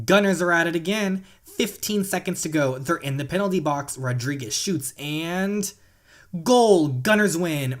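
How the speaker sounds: excited play-by-play sportscaster; sports commentary. Acted. Gamer calling the final minute for viewers, excited play-by-play. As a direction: call the tied match like a live announcer.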